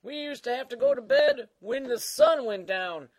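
put-on creaky old-timer voice; Old Man Dan. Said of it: "Old Man Dan".

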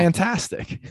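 Fantastic with a high rising pitch. Shown as a demonstration. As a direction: lift Fantastic up high at the end. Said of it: "go get exhausted. Fantastic".

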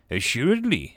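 ordinary delivery

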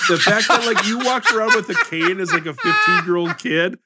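real laughter breaking into mockery; incredulous laughing. Real, not acted. Co-host roasts friend over walking with a cane, incredulous laughing.

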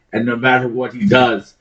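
normal talking voice

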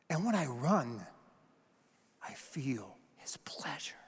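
soft, halting reply to a sister; acted quiet conviction, fading by the end; hushed earnest confession. Acting Eric's quiet confession to sister.

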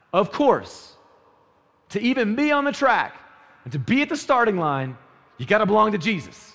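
loud, high preaching build. Acted. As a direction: preach it out with fire. Preaching at full force.

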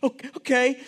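high, stammered, tearful okay; mock crying. Crying Wally answering Tim on the boat, mock tearful voice. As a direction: imitate a scared kid trying to answer through tears. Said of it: "Wally's sitting there crying".

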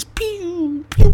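high laser pew sounds; pew pew popping noises. Retold or imitated. Joking about popping herpes pods, high laser pew sounds. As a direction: zap it like Star Wars blasters.

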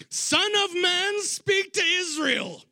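high, slow, proclaiming call; yelling scripture. Retold or imitated. Eunuch reading Isaiah aloud from his chariot, high slow proclaiming yell. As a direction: belt it out like a prophet reading to the sky. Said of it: "he's yelling this stuff".

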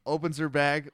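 usual speaking voice

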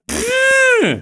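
high squealing party blower noise. Retold or imitated. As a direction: blow a squeaky party horn. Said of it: "I just did it".

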